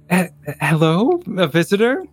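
put-on high, questioning young lady voice. Young lady greeting a visitor at the gate, high-voiced. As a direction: pitch it up high, tentative and questioning. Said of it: "one of the young ladies rises up".

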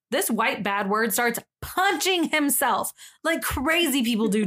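ordinary talk

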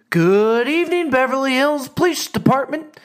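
breezy and relaxed, as if nothing could be urgent; nonchalant Sarah. Retold or imitated. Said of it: "It was the most nonchalant answer".

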